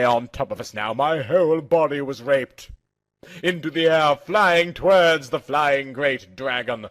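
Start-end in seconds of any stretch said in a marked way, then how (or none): none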